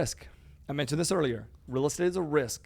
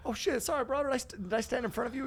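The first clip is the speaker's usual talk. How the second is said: 1 flustered apology; 10 semitones higher than usual. Retold or imitated.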